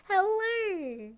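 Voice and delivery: high giggling greeting; giggly cute hello. Acted. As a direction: giggle then coo hello.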